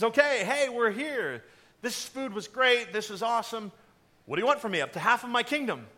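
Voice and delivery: loud, high, jovial banquet host; hammed-up royal enthusiasm; eager king. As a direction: play a king pressing his guest expansively.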